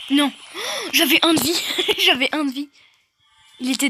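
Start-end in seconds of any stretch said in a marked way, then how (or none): 0.4-0.9 sudden high gasp of shock; sharp gasp. Real, not acted. Just lost with one health point. 0.9-1.6 loud, high cry of disbelief; exclaiming in disbelief. Real, not acted. Lost with one health point. 2.0-2.7 laughing through the words, still loud. Real, not acted. Repeats it, laughing.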